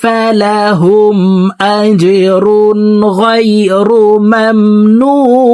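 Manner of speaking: slow chanted verse, long held end; one breath, melodic tajweed; full verse recitation. Shown as a demonstration. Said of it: "আবার বলছি দেখুন". (এক টানে সুরেলা তিলাওয়াত)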